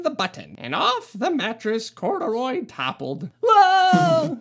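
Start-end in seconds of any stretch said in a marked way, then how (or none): 3.4-4.4 loud, high cry as he falls; startled whoa. Acted. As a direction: yelp as you tumble off. Bear toppling off mattress.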